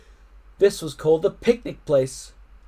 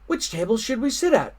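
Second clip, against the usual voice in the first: put-on child asking; 7 semitones higher than usual. A narrator's reading. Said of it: "said one".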